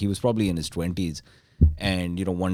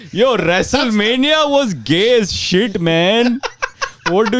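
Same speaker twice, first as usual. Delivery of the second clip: high, loud, slow surfer dude voice; surfer dude dad; 13 semitones higher, 6 dB louder, slower than usual. Retold or imitated. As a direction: play a dad trying to sound cool. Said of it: "would have come up to me and be like".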